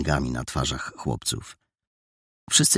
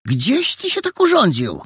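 high, loud worried question; fussing auntie; landlady pressing the injured boy; 4 dB louder than usual. Acted.

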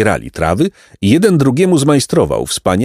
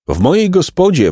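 hawker calling out high; 9 semitones higher than usual. A narrator's reading. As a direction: call the crowd into the inn. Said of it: "wołał jeden".

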